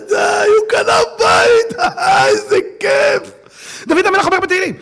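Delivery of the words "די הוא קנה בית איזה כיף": slow, high, loud whining wail; mock envious wail. Envious man wailing over his neighbour's house, mock crying. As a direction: ham up a jealous man crying.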